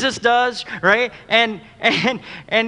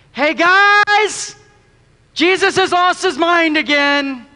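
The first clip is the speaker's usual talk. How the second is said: high, loud aside to the others in disbelief; a disciple blurting out that Jesus has lost it; mock dismay; 7 semitones higher, 5 dB louder, much slower than usual.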